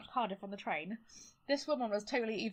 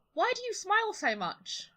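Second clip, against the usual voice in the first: put-on serious little girl; 9 semitones higher, slower than usual. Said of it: "she was like".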